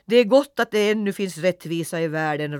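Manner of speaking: loud mooing cow voice; lowing cow. A narrator's reading. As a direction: moo the words out like an old cow. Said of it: "råmade Majros".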